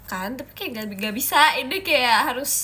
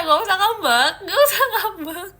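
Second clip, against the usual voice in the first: teasing coax; 8 semitones higher, 6 dB louder than usual. Pretend. Soothing chat teasingly.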